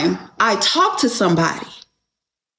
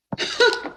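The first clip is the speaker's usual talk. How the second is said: breathy laugh; 13 semitones higher, 4 dB quieter than usual. Real, not acted. Amused at her own story.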